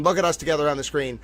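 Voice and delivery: usual speaking voice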